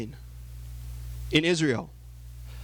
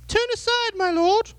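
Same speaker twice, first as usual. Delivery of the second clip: high falsetto seductive woman's voice, much higher; alluring woman. Acted. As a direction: coax him in a high, breathy-sounding lady voice. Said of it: "she came out to meet Sisera and said to him".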